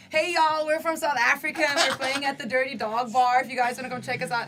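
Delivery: lightly voiced bandmate promoting with a Southern twang; American accent. Bandmate promoting their show to strangers in a light American accent. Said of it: "he's like".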